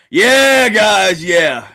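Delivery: high shout falling away; loud exclamation. Real, not acted. Streamer sees the move didn't work, shouting then sinking. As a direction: shout it out, then let it sink.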